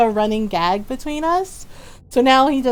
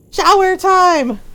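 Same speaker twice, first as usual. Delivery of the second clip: higher, louder, slow sing-song call; cheerful call; 8 semitones higher, 5 dB louder, slower than usual. Real, not acted. Streamer cheering a viewer off to shower, loud and gleeful. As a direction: announce it gleefully to chat.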